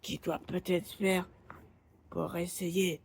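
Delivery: high, eerie stranger voice; creepy lost guy voice. Acted. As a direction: play the unsettling lost man. A figure's voice, far, higher.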